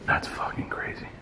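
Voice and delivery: hushed, voiceless disbelief; stunned whisper. Real, not acted. Ghost hunter tells viewers it's crazy, in a stunned whisper. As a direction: whisper it to yourself in shock. Stunned after seeing something move.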